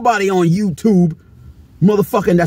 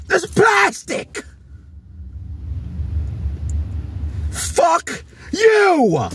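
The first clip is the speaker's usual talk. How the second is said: angry shout; 11 semitones higher, 4 dB quieter, much slower than usual. Real, not acted. Ranting at overpriced toys, shouting in real anger. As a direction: blow up at the price tag. Ranting at toy show prices.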